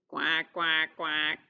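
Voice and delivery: low, steady duck quacks; low-pitched motherly quacking. Acted. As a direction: quack deep like a mama duck. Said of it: "says the mother duck".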